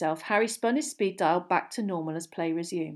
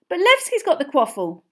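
confused protest; 7 semitones higher, 7 dB louder than usual. A narrator's reading.

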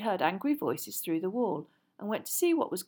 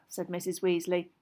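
usual speaking voice

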